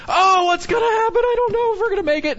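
high, loud fretful wail of a worried crowd; mock panic. Preacher mimics a worried congregation, mock panic.

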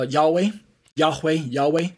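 "Yahweh" in two syllables, repeated; favored pronunciation. Shown as a demonstration. Teacher showing which name he favors, favored pronunciation. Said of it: "I myself favor".

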